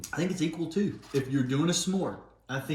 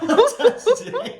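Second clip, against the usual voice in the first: laughing; 12 dB louder than usual. Real, not acted. Laughing at the old candy.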